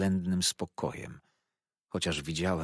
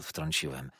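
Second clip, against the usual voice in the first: ordinary delivery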